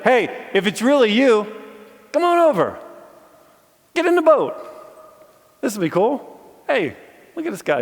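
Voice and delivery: high, loud, eager call across the water; excited call. Retold or imitated. Preacher imitating disciples waving Jesus over, excited. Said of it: "You should be in a boat and be like".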